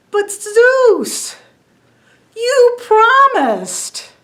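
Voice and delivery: a tiny bee begging a broken promise; pleading little bee. Acted. Little bee protesting to Zeus, high and slow.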